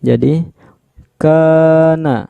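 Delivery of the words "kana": "kana" with the first a held, higher; chanted long "kaa" in "kana"; two-count long vowel. Shown as a demonstration. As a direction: lengthen the first a of "kana", then drop. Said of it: "dibaca panjang dua harokat".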